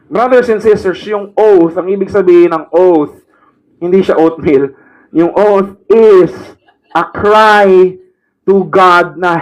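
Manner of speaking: high, loud pulpit voice, driving the point home; fiery preaching. Acted. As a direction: preach it out to the congregation. Preaches at full force.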